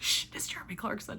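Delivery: urgent shush into a whisper; hushing whisper. Retold or imitated. Teammate hushing her at trivia, secretive whisper. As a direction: whisper it so others can't hear. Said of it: "don't say it too loud".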